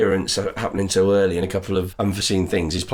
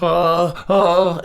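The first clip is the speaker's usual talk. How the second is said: mock scared gasps; 10 semitones higher, 3 dB louder than usual. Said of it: "he's like".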